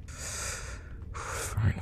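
soft, breathed-out word; resigned sigh. Acted. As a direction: exhale it, very quietly. Gives up on persuading victim.

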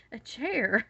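mock-astonished question; incredulous retort. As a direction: act out being baffled by the request. Incredulous at tall husband's request.

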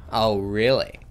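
ordinary talk

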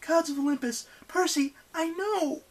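very high, distressed character voice; anguished outburst. A narrator's reading. Annabeth answers Percy about the friends they left, anguished. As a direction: cry it out high, drawing out the end.

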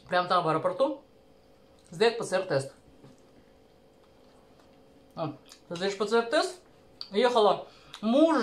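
chewing between words; eating while speaking; talking with mouth full. Real, not acted. Seen 0: Forkfuls of potato go into mouth at 0.3–4.4, mouth stays busy after.

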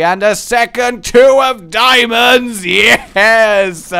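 loud, thrilled shout of joy; excited cheer. Real, not acted. Gamer cheering a lucky drop, loud and thrilled. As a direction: celebrate the lucky draw out loud. Big win in the game.

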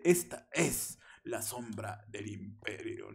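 slow, deep trailer-style narration; lightly voiced epic announcer; dramatic narrator voice. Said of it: "el edit de".

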